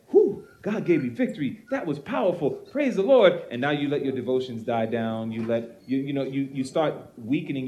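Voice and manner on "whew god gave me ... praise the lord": mock elated. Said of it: "rather than saying".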